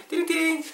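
high-pitched mock chime "ding-a-ling"; playful high ringing imitation; jingle sound. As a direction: sing out a little bell jingle. Sings jingle for tip time.

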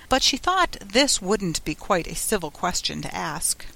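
usual speaking voice

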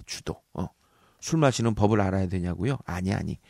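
ordinary talk, nothing marked

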